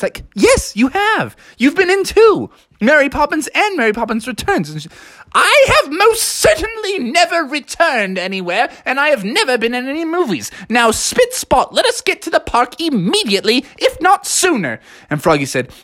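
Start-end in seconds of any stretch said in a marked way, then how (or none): 0.3-4.7 high, emphatic correction; insistent contradicting. Acted. Froggy correcting the nanny about her movies, high and insistent. 5.3-14.8 high, indignant prim nanny; Mary Poppins. Acted. Nanny denying any films, high and indignant.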